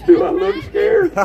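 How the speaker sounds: laughing through a scoffing question. Real, not acted. Asked if she's scared, laughs back at the crowd. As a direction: toss the question back while still laughing.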